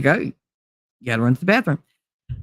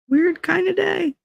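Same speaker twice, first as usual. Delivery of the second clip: much higher, slow weird kind of day; "weird kind of day" drawn out way up high; sing-song weird; 13 semitones higher, 3 dB quieter than usual. Pretend. Repeats phrase differently.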